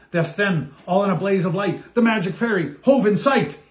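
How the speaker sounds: plain reading voice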